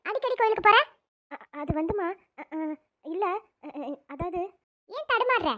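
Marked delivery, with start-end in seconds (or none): 1.3-4.4 frightened stammer. Acted. Girl stammering under questioning.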